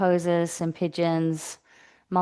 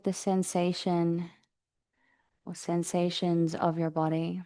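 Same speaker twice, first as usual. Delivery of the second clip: calm, even meditation voice with long pauses; guided meditation; 3 dB quieter than usual. Real, not acted. Yoga teacher settling the class into breathing practice, guided meditation. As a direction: guide them gently into the breath, unhurried.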